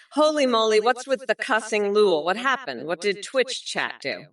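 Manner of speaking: ordinary talk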